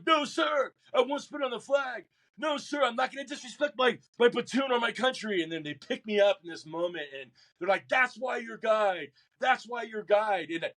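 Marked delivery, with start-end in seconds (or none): 0.1-5.4 pinned-down recruit shouting he won't disrespect his country; shouted refusal. Retold or imitated. Recruit shouting refusal, head pushed down. 7.9-10.5 proud shout. Retold or imitated. Instructors praise him after test.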